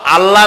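আল্লাহ short, with an open "a" ending; incorrect short pronunciation. Shown as a demonstration. Preacher showing the mistake, short open-vowel pronunciation. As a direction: cut the last vowel of আল্লাহ short and unrounded. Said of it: "আল্লাহ হচ্ছে অশুদ্ধ".

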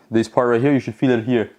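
usual speaking voice

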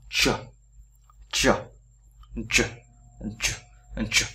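"chờ" cong lưỡi, bật hơi mạnh; đọc "chờ" chậm từng tiếng, cong lưỡi. Shown as a demonstration. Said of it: "phải cong lưỡi". (phát âm "chờ" cong lưỡi)

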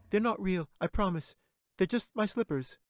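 put-on high, eager girl's voice; anxious little girl. A narrator's reading. Katy pleading with the bear, high girlish worry. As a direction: pitch it up like a worried child.

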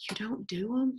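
soft, hushed surprised question; whispered question. Retold or imitated. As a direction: whisper back in disbelief. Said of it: "I whispered to her".

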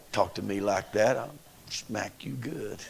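a lightly voiced threat; mother's low threat. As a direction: mutter it like a mama ready to smack. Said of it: "like she wanted to slap him".

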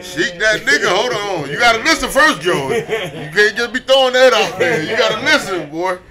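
loud and fired up, laughing; excited. Real, not acted. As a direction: hype it up loudly. Excited defense of [name] album.

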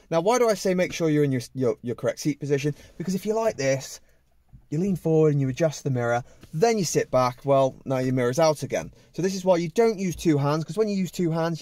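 ordinary talk, nothing marked

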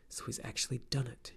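a dismayed aside to himself; muttered to himself. Acted. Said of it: "he muttered to himself".

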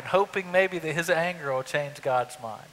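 ordinary delivery

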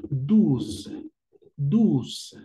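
"Douze" with a French ou and a final z. Shown as a demonstration. Teacher showing students how to say douze. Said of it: "la pronunciación será de esta forma".

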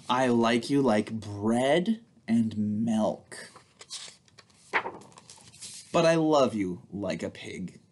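slow, playful picture-book read; reading to children. Acted. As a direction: read it aloud to little ones with big expression. Reads picture book to kids.

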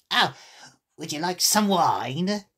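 slow, put-on creature voice; encouraging storybook animal; encouraging March Hare. A narrator's reading. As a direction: offer it invitingly, drawn out. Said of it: "in an encouraging tone".

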